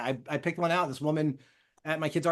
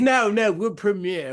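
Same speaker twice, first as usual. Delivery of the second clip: higher, louder, lightly put-on British voice; mock British accent; 5 semitones higher, 6 dB louder, much slower than usual. British fan correcting someone, mock British accent. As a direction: correct them as an indignant Brit. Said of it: "If you're British, imagine you're like".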